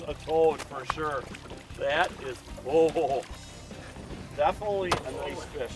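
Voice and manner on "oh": ordinary talk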